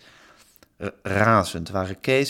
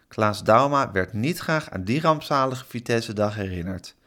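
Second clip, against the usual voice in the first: ordinary delivery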